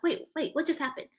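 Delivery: retold confusion, voice lifted; perplexed friend. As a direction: imitate a baffled friend. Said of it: "She was so perpl-, so perplexed".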